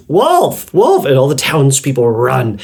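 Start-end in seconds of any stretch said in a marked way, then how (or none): 0.1-1.0 high fake alarm cry of wolf; boy crying wolf. Lonely shepherd boy pretending a wolf to villagers, high fake alarm cry. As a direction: call out wolf like a boy faking danger. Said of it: "so he goes".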